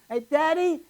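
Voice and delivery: put-on high little kid voice; high child's question; child asking. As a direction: ask innocently like a small child. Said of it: "ask my parents a question".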